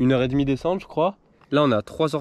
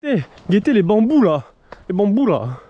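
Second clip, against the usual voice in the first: excited call; 6 semitones higher, 6 dB louder, slower than usual. Real, not acted. Excited at the bamboo.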